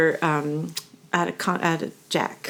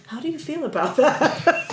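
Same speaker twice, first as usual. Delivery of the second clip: higher, mock-earnest therapist question; retelling a friend turning therapist at a joke-filled dinner, mock-earnest higher voice; imitating a therapist; 9 semitones higher than usual. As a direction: lean in and play the concerned counsellor, pitched up. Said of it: "He leans back and he leans over and he says".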